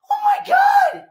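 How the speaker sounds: very high cry of disbelief; overwhelmed scream. Real, not acted. Viewer losing their cool over the episode, overwhelmed scream. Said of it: "wondering why I'm yelling".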